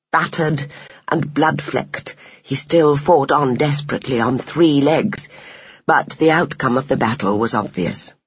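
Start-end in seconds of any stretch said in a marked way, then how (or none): none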